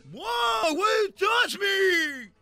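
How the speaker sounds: high, loud, drawn bratty shout; mock yell. Kid sneering at his mother, high drawn mock shout. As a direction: sneer at mom. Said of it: "Ya hablan con".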